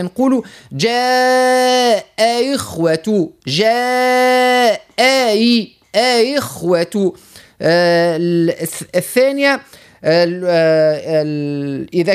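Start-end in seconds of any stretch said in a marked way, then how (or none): 0.7-7.1 very slow "جاء", then "إخوة" with its hamza softened; second hamza eased. Shown as a demonstration. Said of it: "نسهلوا الهمزة الثانية ونحقوا الأولانية". (مدّ "جاء" ببطء شديد ثم تسهيل همزة "إخوة")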